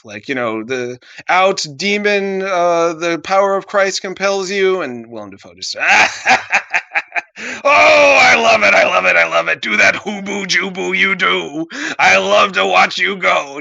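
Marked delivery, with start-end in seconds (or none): 1.3-4.8 high, loud exorcism chant; mock exorcist. 5.8-13.6 high, loud hammed-up cackling delight; gleefully mock, barely containing laughter; cracking up. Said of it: "Just cracking up".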